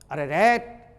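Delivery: usual speaking voice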